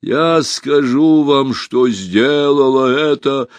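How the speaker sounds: slow, high, loud proclamation; empress's declaration. A narrator's reading. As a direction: declare it loudly, drawing out each word. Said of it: "голос императрицы оборвался".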